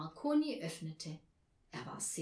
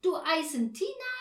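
loud, high, amazed question; astonished; 8 semitones higher, 7 dB louder than usual. A narrator's reading. Herr Marconi learning her name is Tina, astonished. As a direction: ask in broken German, pitched up in wonder. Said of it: "rief Herr Marconi verwundert".

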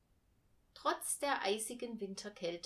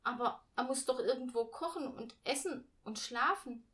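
put-on amazed child; 5 semitones higher, 5 dB louder than usual. A narrator's reading. Girl protests in disbelief.

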